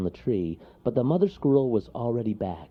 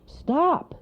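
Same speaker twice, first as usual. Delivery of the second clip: high, sharp cry to halt him; 5 dB louder than usual. Acted. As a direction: yell out to make him stop. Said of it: "he cried".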